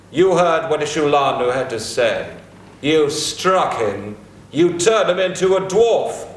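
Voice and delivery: ordinary talk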